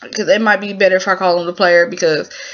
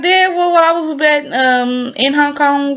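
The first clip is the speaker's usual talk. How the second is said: higher, hesitant son voice; son explaining; 6 semitones higher than usual. Retold or imitated. Son explaining to his dad about Hong Kong, raised and hesitant. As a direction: stall while explaining to dad. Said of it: "And he was like".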